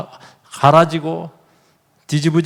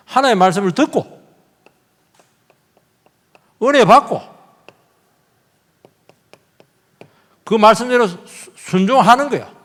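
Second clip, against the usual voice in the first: rising, louder preaching build; 7 semitones higher, 4 dB louder than usual. Acted. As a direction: press the point home like a preacher. Preaching rises in force. (점점 고조되는 설교조)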